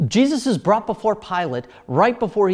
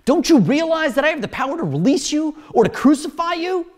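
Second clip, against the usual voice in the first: high, forceful assertion of power; demanding; 7 semitones higher, 4 dB louder than usual. A narrator's reading. Pilate flaunting his power to the prisoner Jesus, demanding. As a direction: press the question hard as a challenge. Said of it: "Pilate demanded".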